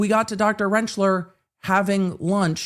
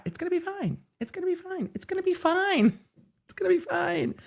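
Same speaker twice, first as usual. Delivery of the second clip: much higher, softer self-reassurance; sing-song it's fine, higher and soft; 13 semitones higher, 5 dB quieter than usual. Real, not acted. Talking herself down after a long day, much higher. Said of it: "reassuring herself repeatedly".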